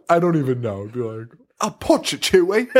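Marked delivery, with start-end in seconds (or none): 1.6-2.7 high, hammy British voice; British Han Solo. Acted. Imagining Englund's audition as Han Solo, hammy British. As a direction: overdo an outrageous British accent. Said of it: "He's fucking British".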